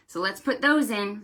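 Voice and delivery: ordinary delivery, nothing marked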